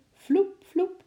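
very high, springy vloep sounds; whoosh of a leap. A narrator's reading. Narrator imitating the squirrel leaping away, high sound effect. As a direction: imitate bounding off, high-pitched.

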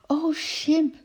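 put-on high, loud excited child; joyful calling out. A narrator's reading. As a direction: cry out in delight, pitch leaping up. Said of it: "riep Lotje". (blij uitroepend, hoog en luid)

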